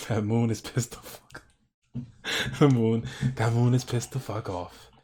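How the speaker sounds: breaking up between phrases; amused laughing. Real, not acted. Painter amused by the angry moon, laughing. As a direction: laugh through the line, restarting it.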